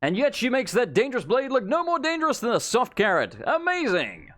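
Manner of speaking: booming showman hype; announcer voice. Acted. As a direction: sell the act to the crowd. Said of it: "use your best announcer voice".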